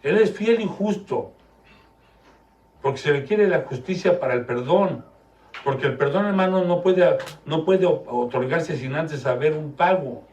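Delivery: loud, high, fervent sermon delivery; preaching at full force. Acted. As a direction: preach it hard to the congregation.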